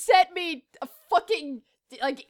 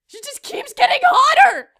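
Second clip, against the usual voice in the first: higher, louder exasperated cry; mock outrage; 5 semitones higher, 6 dB louder than usual. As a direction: burst out in comic exasperation.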